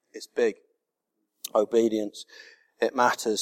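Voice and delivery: a hushed preacher setting the theme; hushed preaching. Acted. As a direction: draw them in quietly. Hushed sermon opening.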